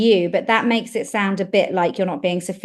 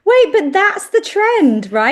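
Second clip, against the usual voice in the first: mock alarm; 11 semitones higher, 6 dB louder, slower than usual. Real, not acted.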